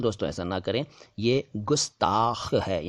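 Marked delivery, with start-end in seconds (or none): 1.6-2.5 गुस्ताख slowly with clean g, raspy final kh; correct hard G. Shown as a demonstration. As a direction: draw out गुस्ताख as gus-taakh, hard g first. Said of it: "ग के नीचे नुक्ता नहीं है".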